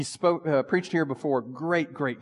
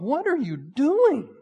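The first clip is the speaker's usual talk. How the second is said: anguished question; 10 semitones higher, slower than usual. Acted. Jesus' grieving question.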